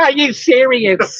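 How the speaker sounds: very slow mock disbelief, syllable by syllable; every syllable pronounced. Woman questioning her date, every syllable pronounced. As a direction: imitate her spelling it out slowly. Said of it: "they pronounce every syllable".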